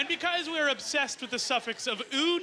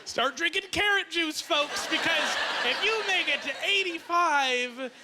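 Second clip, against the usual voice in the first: comic shout; 6 semitones higher than usual. Real, not acted. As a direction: yell it like a pitchman. Comic urging the crowd, shouting.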